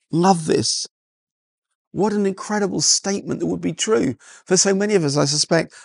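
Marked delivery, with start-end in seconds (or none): none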